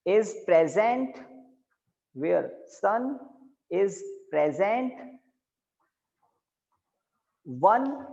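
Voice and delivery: slow word-by-word dictation; dictating. Shown as a demonstration. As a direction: spell it out for notes. Teacher dictating notes slowly. (धीरे-धीरे लिखवाते हुए)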